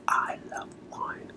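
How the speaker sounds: breathy whispered confession. Shown as a demonstration. Coach whispering a confession to the audience, whispered. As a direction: whisper it like a secret.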